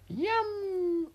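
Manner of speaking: very high, squeaky little bird-like voice; high-pitched cartoon critter; wrong character voice. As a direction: pipe it way up high by mistake. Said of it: "wrong voice".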